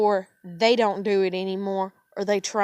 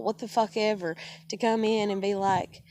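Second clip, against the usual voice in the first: usual speaking voice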